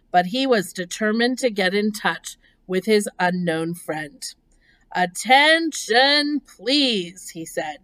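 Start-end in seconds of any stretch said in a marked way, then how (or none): 4.9-7.3 slow, drawn-out formal announcement; firm, deliberate public address; loud, firm voice. A narrator's reading. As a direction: stretch out a raised call for attention. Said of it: "in a loud, firm voice".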